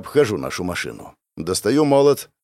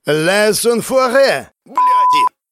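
loud, high, drawn-out yell; slow high shout in French; enraged cursing; 11 semitones higher, 6 dB louder, much slower than usual. Acted. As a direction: curse them at a furious pitch. Enraged driver keeps cursing.

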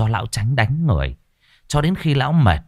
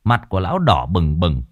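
ordinary delivery

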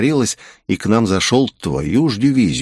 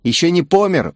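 higher-pitched mocking taunt; jeering, raised-pitch question; mocking sneer; 9 semitones higher than usual. A narrator's reading. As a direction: needle him with a smirk. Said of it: "усмехнулся темноволосый юноша".

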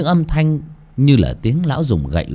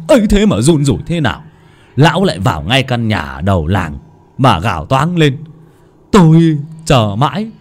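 rising, louder gloating, quick at the start; gleeful telling; 5 semitones higher, 4 dB louder than usual. Acted. Servant Tuật gleefully recounting the death. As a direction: crow over the old man's end. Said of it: "sung sướng mà bảo".

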